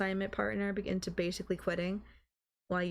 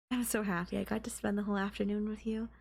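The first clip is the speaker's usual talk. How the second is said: ordinary talk, nothing marked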